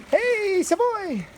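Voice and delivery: high-pitched call to a dog; high puppy greeting. Real, not acted. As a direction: sing out the dog's name.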